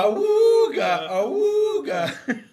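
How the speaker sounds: high, very slow awooga honk; ahooga horn. Pretend. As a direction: stretch it out like an old car horn. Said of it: "We better do this again".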